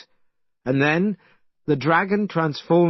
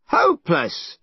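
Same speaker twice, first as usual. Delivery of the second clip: angry shout; 7 semitones higher, 3 dB louder than usual. Acted. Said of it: "shouted Monkey".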